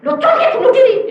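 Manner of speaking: high, loud retort acted out; replaying a shouted objection; alarmed protest. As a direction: cry it out in high-pitched protest. Acts out protest to God.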